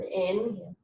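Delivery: normal talking voice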